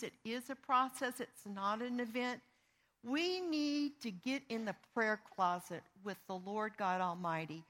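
normal talking voice

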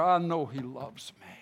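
normal talking voice

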